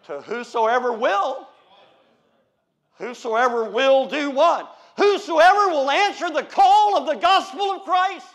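loud, slow, building pulpit cry; loud preacher's cadence; preaching. Acted. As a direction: thunder it from the pulpit. Preaching at full force.

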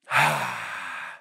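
long slow exhale; audible deep breath out. Shown as a demonstration. Preacher showing the church a deep breath, exhaling slowly. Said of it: "take a deep breath".

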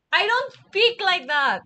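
loud, slow, emphatic denial; indignant protest. Real, not acted. Streamer denying they speak that way, indignant. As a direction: spell out the objection loudly and slowly.

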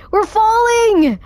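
very high, drawn-out panicked cry. Real, not acted. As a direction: wail it as the fall hits. Repeats in rising alarm.

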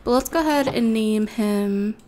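ordinary talk

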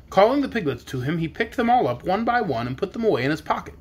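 usual speaking voice

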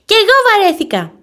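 put-on child yelling back, high and loud; shouting. A narrator's reading. Little rabbit yelling back at his mother, loud. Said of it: "βάζει τις φωνές ο Γιώση".

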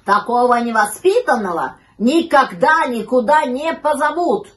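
loud, emphatic rebuke; stern dog's telling-off; scolding dog. A narrator's reading. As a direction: scold with full indignant weight. Indignant dog scolds the puppy.

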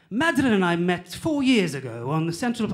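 mock London accent; British accent. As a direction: imitate an Englishman with a put-on British accent. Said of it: "with the British accent".

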